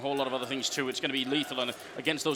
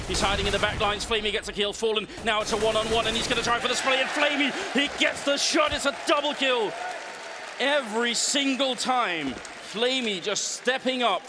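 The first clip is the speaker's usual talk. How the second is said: excited, higher and louder call; esports caster; 6 semitones higher, 3 dB louder than usual. Acted. Live play-by-play of a clutch.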